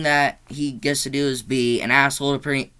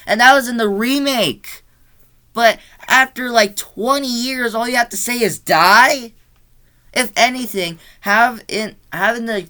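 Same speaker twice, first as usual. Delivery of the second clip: high, loud, exasperated disbelief; incredulous rant; 8 semitones higher, 6 dB louder than usual. Real, not acted. Reviewer mocking Michael's only word, incredulous rant. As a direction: build to a baffled question on the last word.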